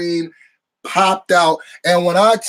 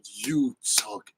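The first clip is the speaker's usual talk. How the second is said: low, quiet, hateful; 10 semitones lower, 10 dB quieter than usual. Retold or imitated. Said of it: "the way she delivered that line".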